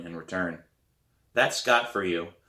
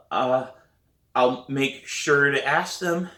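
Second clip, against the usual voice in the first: hesitant, slow, higher answer; stall, then answer uncertainly; wavering voice; 4 semitones higher, 4 dB louder, slower than usual. A narrator's reading. Sam to principal about the message, wavering. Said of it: "My voice wavers as I say".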